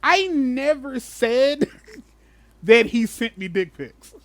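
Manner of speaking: high, slow, drawn-out mock protest; a sneering impression of the backpedaling accuser. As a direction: stretch it out in a sarcastic whiny voice. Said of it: "for the accuser to be like".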